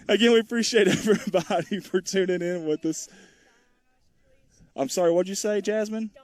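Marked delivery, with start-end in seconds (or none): 0.1-3.1 laughing through thanks. Real, not acted. Laughing while thanking viewers.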